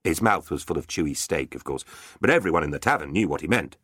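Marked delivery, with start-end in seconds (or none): none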